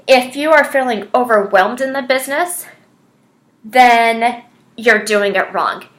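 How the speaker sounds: ordinary talk, nothing marked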